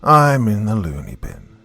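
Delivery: unhinged repeat, lifted on the first words; deranged patient. Acted. Patient taunting his psychologist, deranged refrain. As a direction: let the madman's refrain swell, then fall.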